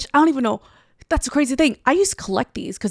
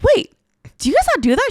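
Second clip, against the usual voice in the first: jumps way up, loud and alarmed; shocked at the dinner table over eating customs; startled realization; 11 semitones higher, 4 dB louder, faster than usual. Retold or imitated. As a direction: caught off guard mid-bite. Realizing a cultural faux pas.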